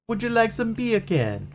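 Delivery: put-on high, sing-song Jamaican accent; mock Jamaican "beer can". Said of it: "in a Jamaican accent".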